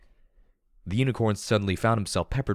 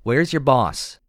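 loud, higher demand; 6 semitones higher, 7 dB louder, much slower than usual. Acted. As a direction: bark the question. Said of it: "said loudly".

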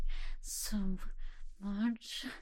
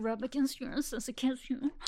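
normal talking voice

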